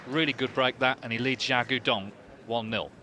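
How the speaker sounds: loud, thrilled snooker commentary; big rising celebration call; excited commentary. Real, not acted. Century clearance completed, commentator erupts.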